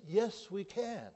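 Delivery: confident reply. Acted. Caleb answers the fearful spies.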